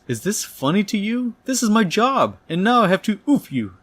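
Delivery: high-pitched, exasperated outburst; freaking out. Retold or imitated. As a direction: get loud and high in a comic freak-out. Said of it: "The Shocker freaks out and says".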